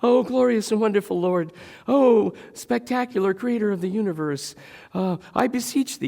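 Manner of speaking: high, loud, hammed-up praise; gushing reverent prayer. Careful believer praying so God isn't hurt, gushing piety. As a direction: flatter God in a mock-pious high voice. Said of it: "I have to be gracious and careful".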